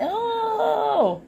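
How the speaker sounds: a gasp of being touched. Retold or imitated. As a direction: melt at a sweet gesture. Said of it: "I'm like".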